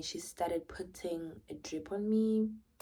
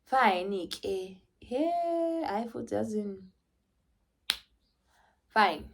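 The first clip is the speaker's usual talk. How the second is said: mock whimper; 3 semitones higher, much slower than usual. Mother caving in to her unborn baby with a whine. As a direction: sulk and give in with a whine. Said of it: "And I was just like".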